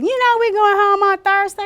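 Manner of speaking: high, loud soothing caregiver voice; calm them with a promise; sing-song reassurance. Shown as a demonstration. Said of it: "you just keep saying".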